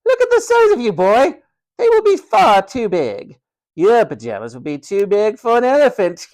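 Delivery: very high, loud, haughty ridicule; mocking matron. A narrator's reading. Matron ridiculing George's size, loud shrieking mockery. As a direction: proclaim it with cruel amusement.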